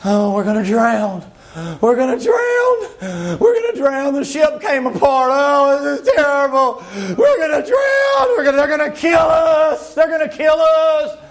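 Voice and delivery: hammed-up terror, rising to a wailing shout; acted panic. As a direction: cry out that the ship is lost. Preacher acts out panicking shipwreck crew.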